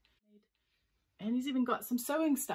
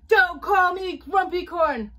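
high, slow, very loud yell; furious holler; 10 semitones higher, 15 dB louder, slower than usual. Acted. Unicorn rejects the nickname, hollering angrily. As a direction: holler every word in outrage. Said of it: "hollered Unicorn".

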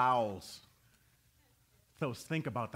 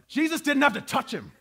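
loud, high sermon climax; fiery gospel preaching; preaching at full force; 10 semitones higher, 11 dB louder than usual. Real, not acted. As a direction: lift the room, preacher in full cry.

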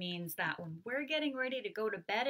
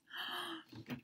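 high, breathy surprised gasp; soft gasp; 10 semitones higher, 9 dB quieter than usual. Acted. Teacher gasping at the bat reveal. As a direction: gasp in wonder.

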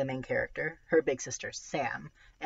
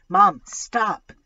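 loud, higher panicked plea; urgent choked cry; 6 semitones higher, 13 dB louder than usual. A narrator's reading. Lily warning Mom of the tiger on the road, urgent cry. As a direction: cry out urgently to halt the car. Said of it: "They come out choked".

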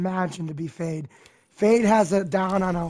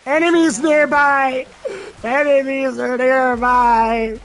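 announcer callout; 4 semitones higher, 5 dB louder, slower than usual. Acted. Echoing the game's callout.

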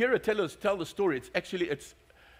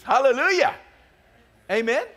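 loud, higher joyful praise; loud hallelujah; 6 semitones higher, 5 dB louder than usual. Real, not acted. Preacher shouting praise to his congregation, loud and high.

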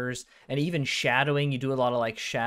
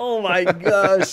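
slow, loud, high gasp of amazement; drawn-out stunned exclamation; amazed oh my gosh; 7 semitones higher, 9 dB louder, much slower than usual. Real, not acted. Interviewer hearing about the pets, slow amazed. Reacting to hidden secret.

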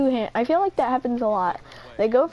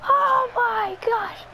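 high-pitched, drawn-out gamer outcry; stretched, high exclamation of disbelief; excited outcry; 9 semitones higher, slower than usual. Real, not acted. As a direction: cry out in real shock at the play. Reacting to a play in the game.

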